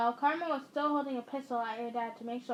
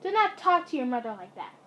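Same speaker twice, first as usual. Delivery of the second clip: ordinary delivery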